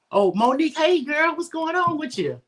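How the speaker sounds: high, loud happy hello; excited greeting. Real, not acted. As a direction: greet a friend with delight. Greeting a viewer by name.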